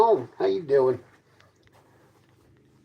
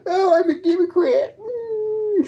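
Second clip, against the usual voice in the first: high, whiny fake sob; mock crying; slower than usual. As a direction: blubber it like a mocking crybaby. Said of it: "All you do is protest and cry, going".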